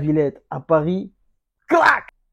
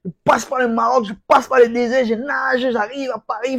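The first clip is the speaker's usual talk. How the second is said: excited storytelling; 6 semitones higher, 4 dB louder than usual. Retold or imitated. Retold migrant journey of Ngannou.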